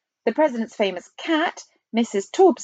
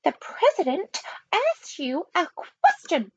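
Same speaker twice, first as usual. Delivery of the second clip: high, icy reprimand; strict nanny, coldly insistent; 9 semitones higher than usual. A narrator's reading. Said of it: "in an icy voice".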